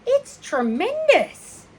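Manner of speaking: put-on high, slow, amazed boy voice; drawn-out wonder at the spider; awed boy. Avery marvelling at the spider, high and slow.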